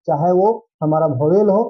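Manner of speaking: normal talking voice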